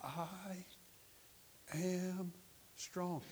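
weak, halting, long pauses; barely able. Retold or imitated. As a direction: imitate a bedridden woman struggling to speak. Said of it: "just barely couldn't whisper".

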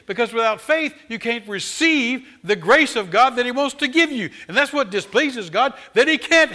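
high, loud preaching that builds and swells; full-force preaching. Acted. Preacher rousing his congregation with fervent loud preaching. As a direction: preach it out to the whole church. Preacher insists Bible stresses faith.

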